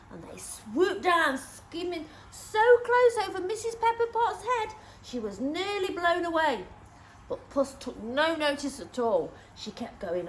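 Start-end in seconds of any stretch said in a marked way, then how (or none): none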